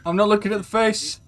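high, loud panicky denial; real high-pitched yell; loud protest. Real, not acted. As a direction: shout it in self-defence. Protesting while avoiding scary face.

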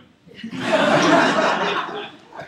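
loud, high airy laughter; breathy laugh. Real, not acted. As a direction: laugh out at the punchline. Laugh after the punchline.

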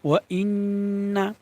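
ইন্না with the doubled n hummed long through the nose; slow, nasal-held n in ইন্না; obligatory ghunnah. Shown as a demonstration. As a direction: hold the n in ওয়া ইন্না before releasing. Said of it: "অজীব গুন্না".

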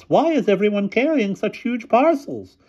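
high, loud headmistress falsetto; fussy headmistress. A narrator's reading. Headmistress at the bus entrance, high astonished voice. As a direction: exclaim in a very high ladylike voice.

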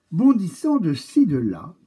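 a sudden high hop on bondissant; bouncy rise. A narrator's reading. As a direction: skip up high like a hopping bird. Narrator bounces the crow along.

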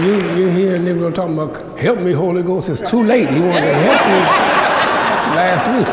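father mocking a last-minute Holy Ghost plea; preacher's thunder.

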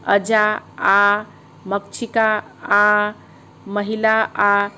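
अजा, मक्षिका, महिला each slow with a held आ; long final aa shown. Shown as a demonstration. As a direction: stretch the closing आ of each word. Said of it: "छात्रा आ आ रहा है". (हर शब्द के अंत का आ लंबा खींचकर धीरे बोलें)

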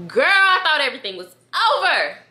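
high, loud cry of disbelief; dismayed outburst. Real, not acted. Telling friends of the shock.

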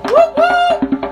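excited whoop; hype cheer. Real, not acted. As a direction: shout it high and excited. Cheering the start.

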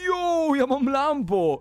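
high, drawn-out whoop of discovery; delighted exclaiming. Pretend. Gamer finds a sports car in the garage, delighted exclaiming. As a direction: stretch it out in amazed delight.

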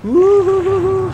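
long, very high "woohoo"; high hooting cry; high whoop. Real, not acted. Whoops during the game.

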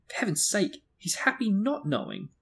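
high, exasperated protest; shrill. A narrator's reading. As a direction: raise the pitch in pleading. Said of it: "said Missus Weasley shrilly".

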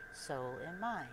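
ordinary delivery

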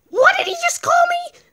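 very high squeaky voice; high-pitched indignation; offended little mushroom. Acted. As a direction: ask indignantly as a tiny creature. Mushroom offended at being called kid.

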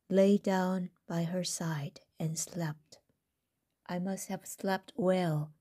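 ordinary delivery

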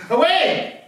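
eager. Acted. Sending Andrew off, eager reply.

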